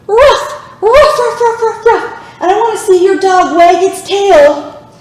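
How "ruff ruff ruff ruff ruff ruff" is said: high playful ruff ruff with a laugh; dog barks. Shown as a demonstration. Teacher in downward dog barking for the kids, high playful ruffs. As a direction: bark like a happy puppy. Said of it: "What sound does a dog make?".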